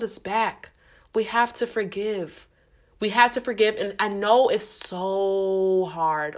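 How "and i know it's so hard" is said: slow, choked-up words "and I know it's so hard"; getting emotional. Real, not acted. Podcast host admitting to listeners how hard forgiving is, choked up and slow. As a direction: let real emotion slow you down. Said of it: "Oh, I'm getting emotional".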